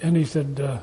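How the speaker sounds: ordinary delivery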